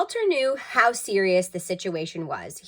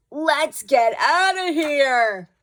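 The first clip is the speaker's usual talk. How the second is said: higher, louder, slow desperate cry; panicked burglars; 8 semitones higher, 8 dB louder, much slower than usual. A narrator's reading. Choking burglars escaping the stink. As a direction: flee for your lives.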